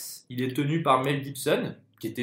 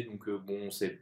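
trailing off; 5 semitones lower, 12 dB quieter, slower than usual. Real, not acted. Speaker hedges and gives up.

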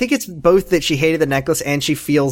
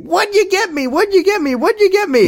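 very high-pitched greedy pestering; begging on repeat, far above normal pitch; gimme-gimme storybook kid. Retold or imitated. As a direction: squeal it like a demanding little cub. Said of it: "straight out of the pages of the fucking Berenstein Bears, is like".

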